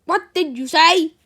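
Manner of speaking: loud, high, incredulous question; shocked demand. Acted. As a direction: demand it in outraged disbelief. Teacher stunned at being tricked.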